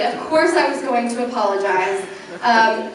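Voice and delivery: normal talking voice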